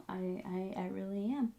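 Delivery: choked-up whisper; real hushed gratitude. Real, not acted. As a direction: drop to a breath, moved by thanks. Overcome with gratitude.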